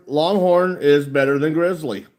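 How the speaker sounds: ordinary talk, nothing marked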